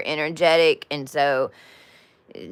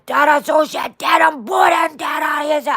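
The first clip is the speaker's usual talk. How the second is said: much higher, loud mock screaming; 11 semitones higher, 4 dB louder than usual. Dragon Dad waking up screaming, high mock shout. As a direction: let out a high, strange scream. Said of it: "he woke up screaming".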